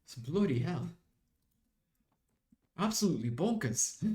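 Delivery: put-on British accent veering South African. Streamer mocking their own accent slip, British-South African accent. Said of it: "then my accent just went".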